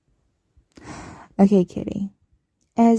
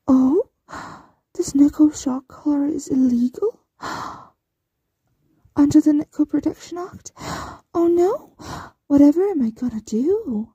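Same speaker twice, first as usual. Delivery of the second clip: mock alarm; 5 semitones higher than usual. Mistress taunting her captive pet with sarcastic fake alarm. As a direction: feign horror to taunt the captive.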